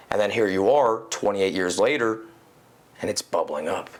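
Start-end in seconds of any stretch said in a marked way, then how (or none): none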